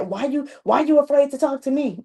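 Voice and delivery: a touch of a sharp mom's snap; mock tone of a mom with attitude; snappy attitude. Mom snapping at her son, with attitude. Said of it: "get no attitude and say".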